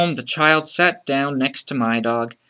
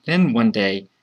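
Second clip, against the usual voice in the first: ordinary delivery, nothing marked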